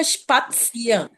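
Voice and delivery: स्पात्सियरन slowly, syllable by syllable, "shpaat-see-ren"; pronunciation demo. Shown as a demonstration. Teacher showing student how syllables join, slow pronunciation demo. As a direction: sound out स्पात्सियरन with drawn-out syllables. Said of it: "pronunciation clear हो जाएगी".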